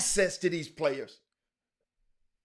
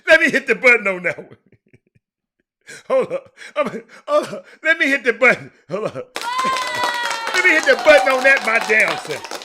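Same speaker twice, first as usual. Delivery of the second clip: words breaking up in laughter, higher and louder; laughing; 8 semitones higher, 11 dB louder than usual. Real, not acted. Host cracking up at a clip, laughing hard. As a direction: laugh through it while reaching for the button.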